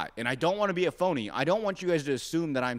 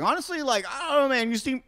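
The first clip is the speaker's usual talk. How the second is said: mock doctor voice; 10 semitones higher than usual. Voicing the doctors.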